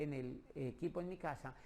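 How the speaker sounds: ordinary talk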